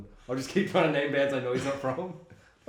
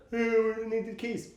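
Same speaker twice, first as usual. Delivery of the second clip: lightly voiced prisoners calling for keys, pitched up; mock a needy call, higher than usual; pleading prisoners; 7 semitones higher than usual. Acting prisoners begging for keys.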